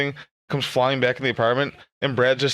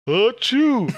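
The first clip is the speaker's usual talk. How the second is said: mock sneeze; 8 semitones higher than usual. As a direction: fake a big sneeze. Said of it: "He goes".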